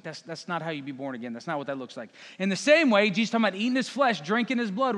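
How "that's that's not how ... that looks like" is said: low, soft, quick aside; acted understated exasperation, low and hurried; patient correction. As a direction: correct them under your breath, pitched down. Jesus correcting Nicodemus, acted.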